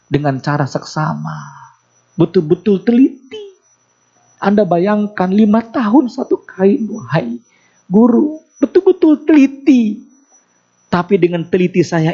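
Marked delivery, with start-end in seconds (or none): 2.2-3.5 rising plea on "betul-betul teliti", pitched up and pressed; pleading grandmother. Acted. The grandmother telling Imam Malik of her careful work, earnest rising plea. 4.4-8.4 pleading grandmother. Acted. Grandmother pleading with Imam Malik. 8.6-10.0 "betul-betul teliti" cried out much higher and louder, near breaking; anguished cry. Acted. The grandmother crying to Imam Malik about her five years' work, high anguished cry.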